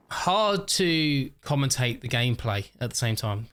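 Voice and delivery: normal talking voice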